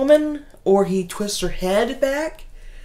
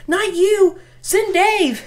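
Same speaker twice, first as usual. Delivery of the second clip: put-on much higher, louder bossy kid; scared kid; 13 semitones higher, 4 dB louder, slower than usual. Kid orders babysitter to send boyfriend, high bossy. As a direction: insist like a scared little kid. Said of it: "kid pointing at boyfriend".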